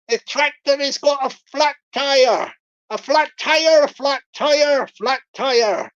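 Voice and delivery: hammed-up Bristol farmer accent, insistent; Bristol scammer voice. Scammer repeating flat tyre story to Richard, mock Bristol accent. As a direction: repeat it emphatically in broad Bristol. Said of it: "I'll do the Bristol voice".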